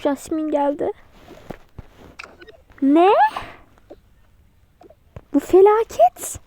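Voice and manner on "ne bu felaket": high, loud cry of dismay; dramatic cry. Acted. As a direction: wail it like a disaster. Panic at Jasmine's arrival.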